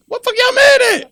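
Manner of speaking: loud, high, angry outburst; exasperated shout; heated shout. Real, not acted. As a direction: yell it in disbelief at the room. Heated rant about blame.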